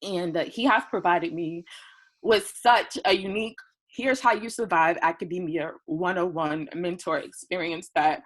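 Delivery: ordinary talk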